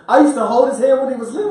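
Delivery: laughing protest. Real, not acted.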